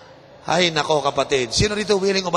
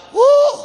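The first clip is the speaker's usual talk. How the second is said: high, loud "woo"; delighted whoop; 10 dB louder than usual. Acted. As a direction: whoop as if the shower hits you. Acting out shower enjoyment. (malakas at matinis na hiyaw sa tuwa)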